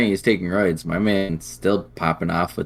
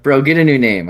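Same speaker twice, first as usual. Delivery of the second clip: higher, louder playful telling-off; comic indignation, pitched up; mock scolding; 6 semitones higher, 6 dB louder than usual. Real, not acted. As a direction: tell him off in pretend annoyance. Mock scolding an absent player.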